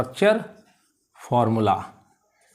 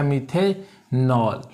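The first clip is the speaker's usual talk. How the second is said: slow, stretched methanol with a pause before नॉल; syllable by syllable; 3 semitones lower, much slower than usual. Shown as a demonstration. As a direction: sound out methanol in two drawn-out parts. Teacher sounds out new term.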